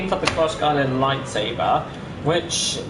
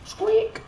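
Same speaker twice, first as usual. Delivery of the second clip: squeaky squeal. Pretend. Squeaky toy noise in game.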